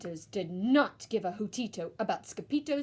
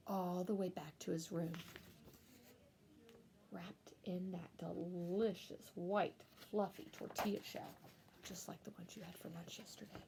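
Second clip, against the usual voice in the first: put-on whispered to the listening child; 4 semitones lower, 12 dB quieter than usual. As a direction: lull them with a hush. Bedtime whisper ending.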